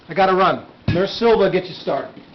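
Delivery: loud, hurried call to the nurse; urgent call. Acted. Doctor rushing to a crashing boy, loud call to the nurse. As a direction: raise your voice as you dash off to the emergency.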